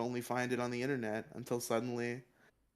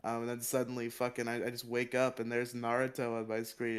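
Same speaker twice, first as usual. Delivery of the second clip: usual speaking voice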